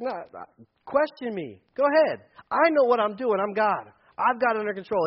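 a God daring you to question; confident God. Pretend. Pastor voices God inviting questions, bold and loud.

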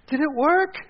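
high, loud call from upstairs; yelling down. Retold or imitated. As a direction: call the question out to another floor. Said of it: "Cara yells down from the stairs".

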